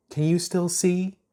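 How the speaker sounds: put-on high, slow girl voice. A narrator's reading. Chuck covering Ponder's eyes.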